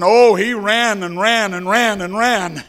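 breathless storytelling urgency; urgent chase. A narrator's reading. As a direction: pour out the boy's desperate running. Boy chasing the lost boat.

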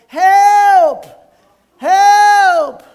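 high, loud shout for help from the rope; desperate cry for help. Retold or imitated. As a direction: yell for help, way up high and full voice. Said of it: "so he cried".

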